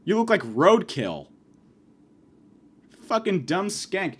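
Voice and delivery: ordinary delivery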